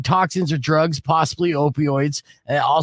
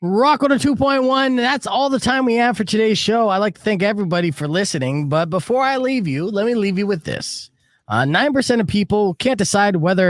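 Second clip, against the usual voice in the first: radio announcer; 5 semitones higher than usual. Acted. Radio sign-off.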